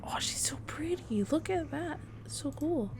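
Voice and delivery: high, hushed admiring whisper; awed whisper. Real, not acted. Gamer whispers in awe at the pretty witch. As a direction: marvel at her softly.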